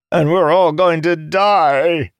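slow, high, loud doom; despairing. A narrator's reading. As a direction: wail it as certain doom. Jacob despairs at the plan.

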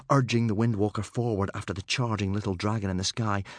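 ordinary delivery, nothing marked

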